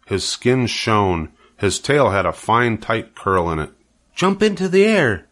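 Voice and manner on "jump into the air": high, eager cry. Acted. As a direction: call it out high to the pig. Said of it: "cried Charlotte".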